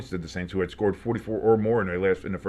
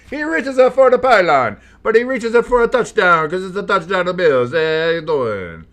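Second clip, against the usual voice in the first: hammed-up Italian accent, high and loud; mock Italian accent, loud and high; 7 semitones higher, 7 dB louder than usual. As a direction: play a loud cartoon Italian announcer. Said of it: "That was mildly ethnically offensive".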